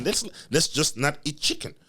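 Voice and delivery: normal talking voice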